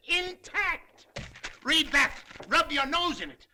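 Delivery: normal talking voice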